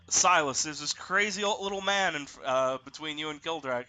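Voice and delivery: normal talking voice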